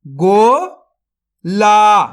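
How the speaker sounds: गोला drawn out very slowly, syllable by syllable; sounded out slowly. Shown as a demonstration. Teacher sounds out a word for young readers, very slowly. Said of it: "तो मिलके बन जाएगा".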